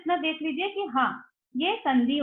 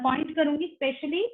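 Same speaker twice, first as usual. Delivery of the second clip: ordinary talk, nothing marked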